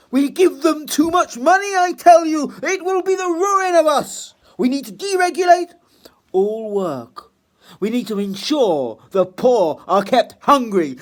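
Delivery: very high, loud outraged squeal; shrill pompous toff. Acted. Mister Trite rants over the poor eating tripe, very high and loud. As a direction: squeal in aristocratic horror.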